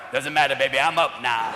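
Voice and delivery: low, laid-back player voice; coked-up player. Retold or imitated. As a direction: brush it off cool and deep.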